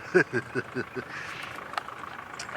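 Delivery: soft, wheezy mock laugh; quiet shaking chuckle, acted out; imitated helpless laughter. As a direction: imitate the tracker laughing, almost voiceless. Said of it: "because he was laughing so much".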